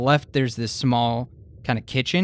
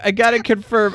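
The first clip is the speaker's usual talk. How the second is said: ordinary talk, nothing marked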